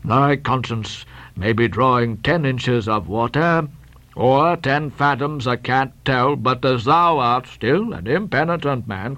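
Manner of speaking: measured, ominous sermonizing; steady admonition. Acted. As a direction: pronounce doom with unshaken calm. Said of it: "said Bildad steadily".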